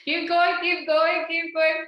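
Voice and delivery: loud, high cheering call; loud encouragement. Real, not acted. Instructor cheering participants to keep moving, loud and high. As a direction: cheer them on to keep going. Coach urging movement.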